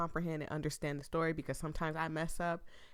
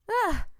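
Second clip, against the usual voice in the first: sharp high startled shriek; a sudden loud frightened yelp; startled scream; 14 semitones higher, 11 dB louder than usual. Acted. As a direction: shriek as if caught off guard. Scared scream in the story.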